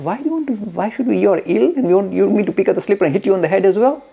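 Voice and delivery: high, loud, quickening disbelief; retold baffled protest. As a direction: act out a taken-aback disciple objecting. Disciple balks at hitting sick master.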